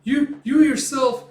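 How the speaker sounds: high, loud indignant outburst; shouting back high-pitched and furious; angry. Retold or imitated. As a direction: yell it in outraged disbelief. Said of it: "Puck's getting all angry".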